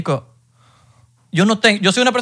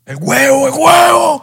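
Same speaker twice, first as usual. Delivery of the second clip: high, loud, rising shout; excited yell of the answer; 9 semitones higher, 3 dB louder, slower than usual. Real, not acted. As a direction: blurt it out as a shout. Shouting answer about what girls like.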